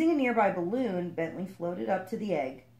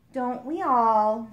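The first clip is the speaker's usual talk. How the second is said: slow, louder sighing goldfish; weary sigh; 5 semitones higher, 8 dB louder, much slower than usual. A narrator's reading. Goldfish commiserating with the frog, slow weary sigh. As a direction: let it out with a sigh. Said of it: "sighed the goldfish".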